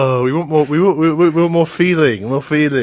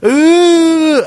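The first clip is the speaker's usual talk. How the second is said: long, loud, high-pitched ooh; crowd-style "ooh"; 9 dB louder than usual. Retold or imitated. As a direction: belt out a held high "ooh". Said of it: "that everyone went".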